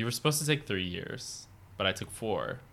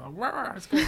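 talking through a mouthful; Scooby-Doo style mouthful mumble; mouth full; 3 dB louder than usual. Retold or imitated. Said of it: "You become Scooby-Doo".

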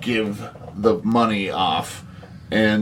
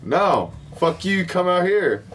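higher mock refusal; half-acted stubborn refusal, pitched up; mock defiant [name]; 7 semitones higher than usual. [name] refusing the blackmailers, high mock anger.

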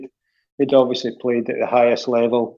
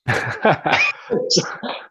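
laugh; 7 semitones higher, 4 dB quieter than usual. Real, not acted. Laugh after the punchline.

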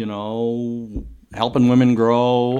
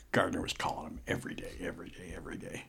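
dropping to a near-whisper; whispering aside about a persistent recruiter; hushed; 4 semitones lower, 14 dB quieter, faster than usual. Real, not acted. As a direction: lean in and hush it, like a secret. Conspiratorial aside in the story.

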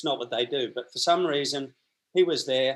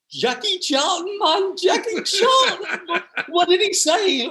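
high, loud, hammed-up disbelief; mock-astonished high-pitched outburst; astounded shriek; 6 dB louder than usual. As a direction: shriek it like a starstruck local. Acted driver astounded at the name.

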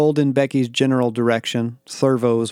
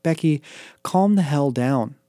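higher, slowed-down insistence; insistent; 4 semitones higher than usual. A narrator's reading. Daniel telling Becky to calm down, insistent. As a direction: spell it out firmly to settle a friend. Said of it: "I insisted".

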